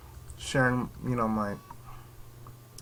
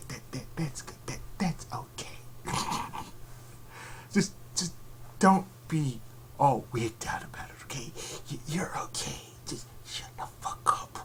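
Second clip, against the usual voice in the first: mock soothing whisper; 9 dB quieter than usual.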